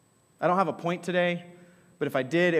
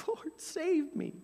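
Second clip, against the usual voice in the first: 1 pleading cry; 14 semitones higher, 6 dB quieter, slower than usual. Pretend. Cry while sinking.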